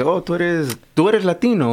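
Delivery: mock surprised exclaim. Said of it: "cuando la gente dice".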